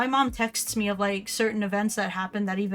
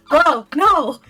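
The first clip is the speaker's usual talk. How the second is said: louder, higher "bro, no"; loud protest; 6 semitones higher, 11 dB louder than usual. Retold or imitated. Telling a friend off for his insult, loud protest. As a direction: shut the friend down loudly. S0 scolding friend in retold story.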